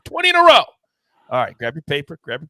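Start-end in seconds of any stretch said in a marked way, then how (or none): 0.0-0.6 high, loud opening cry, a streak celebrated; excited shout. Real, not acted. Opening hype on a winning streak.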